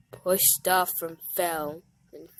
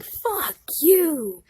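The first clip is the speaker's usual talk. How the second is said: yelled insult; 8 semitones higher, 6 dB louder than usual. Acted. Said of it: "Val yells back".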